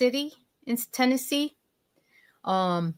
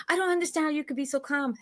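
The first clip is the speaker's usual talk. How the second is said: quick, higher, lightly mocked worry; imitating a nervous cousin; 6 semitones higher, 4 dB quieter, faster than usual. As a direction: quote her fretting, fast and light. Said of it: "I'm sitting there".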